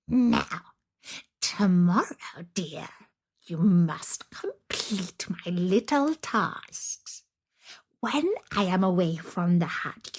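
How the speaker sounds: slow, half-whispered witch threat; menacing witch. Acted. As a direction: hiss the terms like a wicked hag. Witch voice held.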